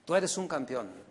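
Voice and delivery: low, quiet, half-hearted campeón; preacher quoting a motivational pastor, low and dry; flat motivator line. Retold or imitated. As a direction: toss off the slogan under your breath. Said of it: "el pastor se convierte en un motivador".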